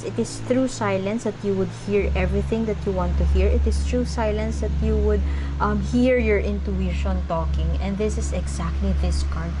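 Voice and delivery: usual speaking voice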